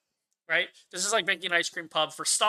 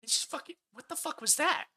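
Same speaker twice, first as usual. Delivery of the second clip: retold theatre mutter of disbelief; stunned half-whisper; 8 semitones higher, 6 dB quieter than usual. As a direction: mutter it in shock under your breath. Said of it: "I sat there and went".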